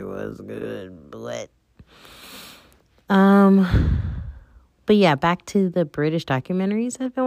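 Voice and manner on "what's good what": confused mumble. Retold or imitated. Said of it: "You'll be like".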